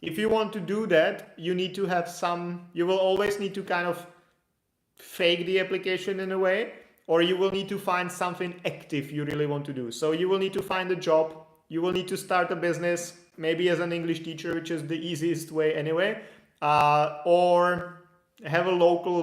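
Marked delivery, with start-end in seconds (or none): none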